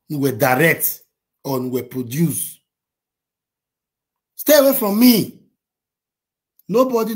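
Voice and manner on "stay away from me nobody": loud, high shout. Real, not acted. Ranting at a critic, shouts at them to stay away. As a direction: yell it to push them off.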